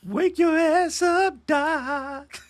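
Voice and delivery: very high, drawn-out put-on voice; Val as Siri. Host imagining a friend as voice assistant, high mock voice. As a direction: squeal it slowly, stretching every word. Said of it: "it'd be cool we had Val's voice inside the Siri".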